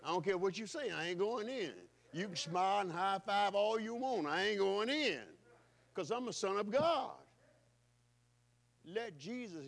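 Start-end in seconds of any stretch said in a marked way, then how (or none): 2.1-5.4 rising defiance, slowing on the last line; defiant preaching. Acted. Preacher to congregation, defiant and emphatic. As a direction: hammer home that he won't go in.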